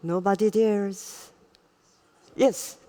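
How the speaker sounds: normal talking voice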